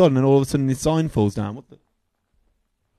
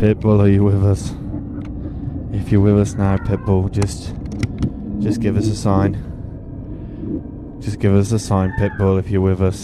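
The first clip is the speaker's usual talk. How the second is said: séance medium; 3 semitones lower than usual. Acted. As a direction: call out to the spirit like a hammed-up medium. Calls on [name] like a séance.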